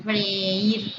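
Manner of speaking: freír in two syllables, fre-ír; freír with the stress on a separate í. Shown as a demonstration. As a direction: split the e and í in freír. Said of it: "están en sílabas separadas".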